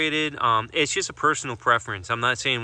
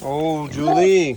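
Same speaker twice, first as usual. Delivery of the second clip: slow, louder, lifted coo of a name; drawn-out pet greeting; 4 semitones higher, 7 dB louder, much slower than usual. Real, not acted. Greeting her pet Julie, slow fond call. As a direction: stretch the name out fondly.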